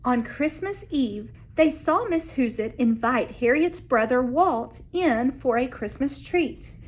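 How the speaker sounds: ordinary delivery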